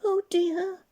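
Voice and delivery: high, loud gasp of alarm; gasped fright. Acted. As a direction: gasp it at the sight of teeth. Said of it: "gasped Jack".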